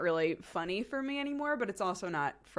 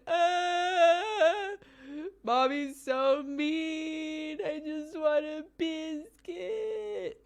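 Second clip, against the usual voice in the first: high, loud, very slow whining; hammed-up high toddler whine; mock toddler whine; 9 semitones higher, 5 dB louder, much slower than usual. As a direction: ham up a sulking child.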